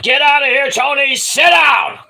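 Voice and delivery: loud, high barked order to leave; angry shout. Retold or imitated. Wrestlers shouting the interviewer off, high angry yell. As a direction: throw him out of the ring area with a yell. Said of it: "Sanjay Dutt and Jay Lethal said".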